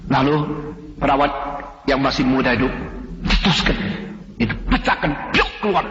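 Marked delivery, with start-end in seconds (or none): none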